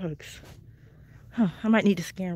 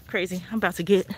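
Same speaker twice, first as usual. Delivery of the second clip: ordinary talk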